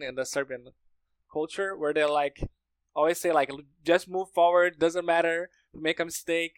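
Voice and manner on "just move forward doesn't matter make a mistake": usual speaking voice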